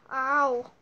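ordinary talk

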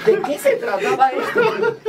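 high, laughing what-is-this; amused disbelief, high and laughing; laughing bewilderment. Retold or imitated. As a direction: ask it high through giggles, baffled. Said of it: "así como diciendo".

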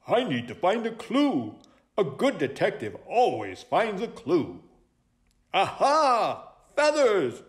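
high, lively sleuth voice; excited high-pitched owl sleuth; eager owl detective. Acted. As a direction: announce it like a keen detective.

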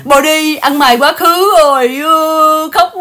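high, loud drawn-out fake bawling; mock wailing. Host mocks a couple crying online over the past, mock wailing. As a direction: wail it out in exaggerated, stretched sobs.

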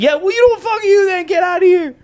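very high, loud acted yell; mad. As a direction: scream them out of the house. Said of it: "And I was mad".

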